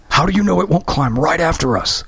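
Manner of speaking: high frightened scream; hoarse scream. Acted. Graham screaming at Christy in fear. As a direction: scream it in terror. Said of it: "he screamed hoarsely".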